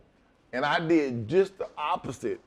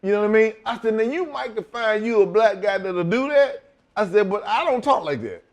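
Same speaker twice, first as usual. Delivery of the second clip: heated refusal; 8 semitones higher, 4 dB louder than usual. Retold or imitated.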